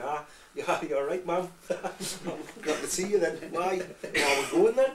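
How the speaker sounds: put-on high Geordie accent, lilting up; Geordie lad. Little lad greeting his horrified parents, Geordie accent. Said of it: "this little Geordie lad saying".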